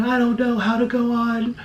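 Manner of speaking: high, weepy pretend sob; mock crying girl. Pretend victim to commenters, high weepy mock sob. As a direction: whimper it like a fake heartbroken victim. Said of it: "holding myself and crying and saying".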